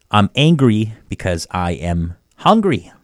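usual speaking voice

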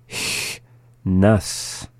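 "Chnas" broken into a long hissed "Ch", then "nas"; slowly. Shown as a demonstration. Teacher giving word examples, "Chnas" said slowly in parts. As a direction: draw "Chnas" out in two separate parts. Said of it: "the second time slowly".

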